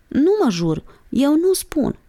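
put-on high, stubborn childlike refusal. A narrator's reading. Monica refusing to swear an oath, stubborn. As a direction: dig your heels in, pitched high. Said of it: "se încăpățână Monica".

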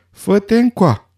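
high, drawn-out yell; shouted summons. A narrator's reading. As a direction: call the dog over, high and stretched. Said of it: "țipă căruțașul". (strigăt înalt și prelungit)

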